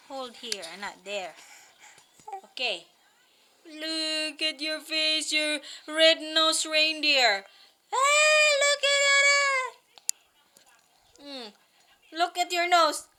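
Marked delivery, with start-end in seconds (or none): 3.6-7.5 slow, drawn-out fond tease; teasing coo. Real, not acted. Mother teases crying child about red-nosed face, drawling playfully. 7.9-9.7 very high, slow, stretched-out coo; cooing tease. Real, not acted. Mother points out crying child's red face, high teasing coo.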